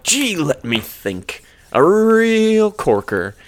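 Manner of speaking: slow, drawn-out character voice; mock grandpa. Grandpa thinking up a story, slow mock old-man voice. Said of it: "Grandpa's like".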